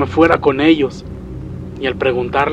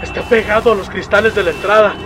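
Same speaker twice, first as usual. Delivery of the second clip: terrified; 7 semitones higher than usual. Acted. Father describing the creature at the glass to his child, terrified. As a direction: report it in mounting horror.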